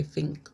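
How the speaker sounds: "Think" said softly as "fink"; F at the start of "Think". Shown as a demonstration. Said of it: "F I N K".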